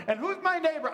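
mock lawyer question. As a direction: squeak out "neighbor" like a cornered lawyer. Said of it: "he goes, I love this".

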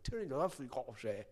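garbled, lilting Scottish imitation; mock Scottish accent. Scottish cop giving baffling directions, garbled mock accent. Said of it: "the Scottish guy gives me directions".

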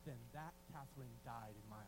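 very low, hushed divine pronouncement; voice of the Lord. Retold or imitated. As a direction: speak softly and deep, as God. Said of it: "Then the Lord says".

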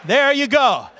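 high, loud told-you-so; re-enacted cocky victory line; triumphant. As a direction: toss it off in triumph. Re-enacts triumphant remark to bullies.

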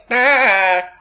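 high, put-on voice of his buddy; a friend's voice, baffled and a bit rough; imitating his friend. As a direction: mimic how the friend talks, incredulous. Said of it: "he talks like this".

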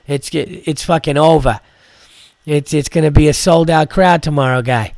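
mock Boston doorman; lightly voiced Boston accent with a casual swagger; Boston accent impression. Comic imitating the Boston club doorman turning them away in a light Boston accent. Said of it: "with a Boston accent".

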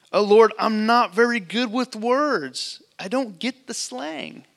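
a hint of someone old and lost, who doesn't get the slang; mock out-of-touch Moses. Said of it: "I'm not hip with the words".

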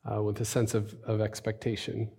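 hushed, low-key prayer with long pauses. Acted. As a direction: pray softly, searching for the words. Leads congregation in prayer.

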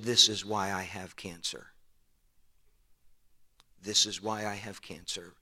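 low, hushed, voiced imitation of a whisper; retold whisper. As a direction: confide it softly, as if into an ear. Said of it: "leaned over and whispered in my ear".

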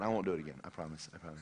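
low, quiet little assurance; playing a bully's hushed promise; mock promise. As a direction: murmur a false promise, fading away. Bully's false promise, retold.

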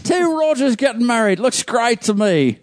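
put-on fail at an Australian accent on purpose; badly faked Australian accent, high and drawn out. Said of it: "I can't do that accent".

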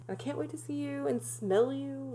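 soft, lilting mock devotion; imitating smitten boyfriend. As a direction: lightly mimic a lovesick caller. Voices boyfriend on phone to girlfriend.

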